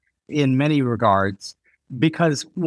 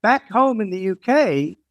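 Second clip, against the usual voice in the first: lightly voiced Brit, mostly higher; British designer; 7 semitones higher than usual. British designer weighing her accent here and back home, lightly imitated. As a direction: retell her contrast in a raised voice. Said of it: "And she goes".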